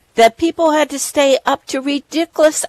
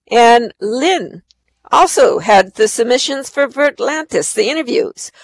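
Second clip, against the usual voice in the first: ordinary talk, nothing marked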